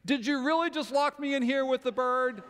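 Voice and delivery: high, loud disbelieving yell; trapped girl yells through the door in disbelief; shocked outcry. Retold or imitated. Daughter locked in with bird, yelling in outraged disbelief. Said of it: "you hear this".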